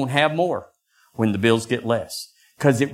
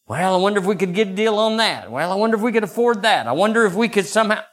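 scheming musing; 8 semitones higher, faster than usual. Retold or imitated. Inner voice of scheming pastor.

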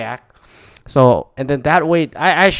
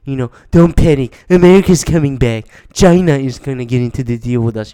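louder, pumped-up reassuring politician voice; mock president; 6 dB louder than usual. President tweeting to calm the market, louder mock hype. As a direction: hype it up like a salesman promising a deal. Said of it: "he's like".

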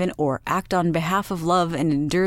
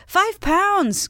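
excited exclamation; 13 semitones higher, 3 dB louder than usual. A narrator's reading. Wife shocked by the scale.

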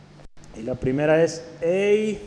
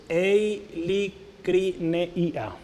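eilicrineia broken into slow, separate syllables; sounding out; 4 dB quieter, much slower than usual. Shown as a demonstration. Preacher repeating a Greek word for note-takers, syllable by syllable. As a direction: spell out eilicrineia by ear, very slowly with gaps.

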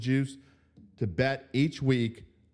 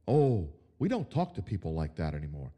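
mock prim, lower, precious tone; mocking prissy voice; 7 semitones lower than usual. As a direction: parody a too-polite coach. Mocking soft-coach attitude.

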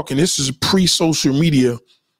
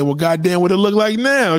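mock stunned curiosity; 6 semitones higher than usual. Host imitating his own reaction to the reduction, mock amazed. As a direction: act out your own nosy surprise.

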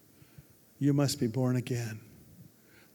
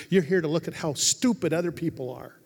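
usual speaking voice

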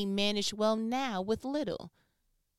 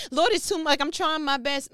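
higher, louder pleading to God; overwhelmed plea; 5 semitones higher, 6 dB louder than usual. Acted. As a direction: beg for relief like it's all too much. Believer complaining to God in prayer.

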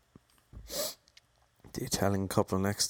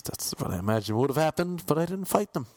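ordinary delivery

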